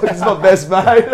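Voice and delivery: excited laughing boast about a stranger. Retold or imitated. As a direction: brag about the new friend through a laugh. Showing off the German dude.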